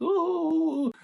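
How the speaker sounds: mock high squeal of delight; drawn-out falsetto ooh; high-pitched ooh. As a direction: imitate her swooning with a long, very high ooh. She will be like, acted girl reaction.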